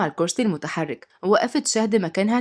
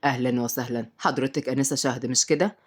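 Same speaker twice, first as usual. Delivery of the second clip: low, softer male character voice; courteous host, deep and soft; welcoming man; 7 semitones lower, 4 dB quieter than usual. A narrator's reading. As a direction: greet a newcomer with a smile, pitched down. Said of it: "وهو بيرحب بيها وقال بابتسامة".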